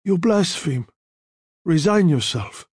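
stern rebuke without comfort; terse, unconsoling Don; cold. A narrator's reading. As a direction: coldly shut down a dying friend. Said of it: "his voice was cold, without comfort".

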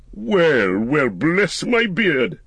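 slow, high, drawn-out surprise; mock astonishment. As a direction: relish catching someone out, with swooping pitch. Wizard finds an eavesdropper.

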